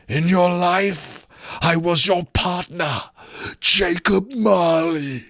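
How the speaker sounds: high, very slow, eerie ghost; slow ghostly voice. Acted. Marley's ghost challenging Scrooge, slow and eerie. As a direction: draw it out from beyond the grave.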